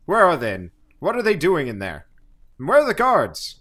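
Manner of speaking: loud, high, barking officer; angry commander. Acted. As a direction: bark it like an irate field marshal. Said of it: "he's like".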